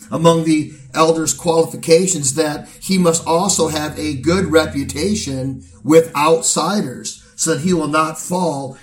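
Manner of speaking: steady pulpit preaching to the congregation. Acted. Pastor charging the church at an elder's ordination, in steady preaching voice. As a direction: deliver it as a sermon from the pulpit.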